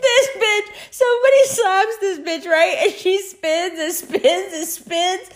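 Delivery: very high, squealing through real laughter; helpless laughter. Real, not acted. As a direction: tell it while cracking up. Helpless laughter retelling absurd scene.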